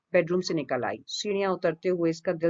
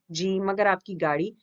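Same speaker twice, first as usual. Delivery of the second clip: ordinary delivery, nothing marked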